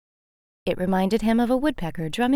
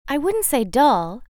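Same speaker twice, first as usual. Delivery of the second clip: higher, slow, gentle correction; butterfly taking back her words, higher and slow; correcting butterfly; 6 semitones higher than usual. Acted. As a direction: soften what she said before. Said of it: "the butterfly corrected".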